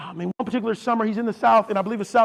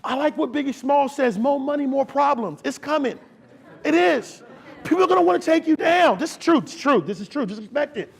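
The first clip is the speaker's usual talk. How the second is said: loud, high sermon exhortation; fired-up preaching; 6 semitones higher, 5 dB louder than usual. Real, not acted. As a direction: punch it out to the congregation with force. Preacher warns future leaders, fired up.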